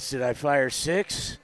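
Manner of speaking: lightly voiced Dirty Harry; Clint Eastwood impression. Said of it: "since I have a cold, I can do it".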